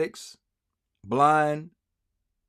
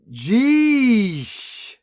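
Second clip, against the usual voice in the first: mock sigh word; 7 semitones higher, 7 dB louder than usual. Reading profile word mockingly.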